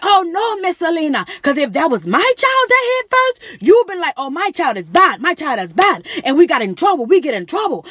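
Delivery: high, loud protesting voice; heated complaint. Retold or imitated. Mother protesting to staff member, loud heated voice. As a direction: argue back hard at the staff. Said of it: "she like".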